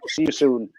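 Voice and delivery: ordinary delivery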